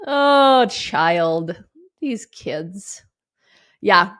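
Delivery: ordinary talk, nothing marked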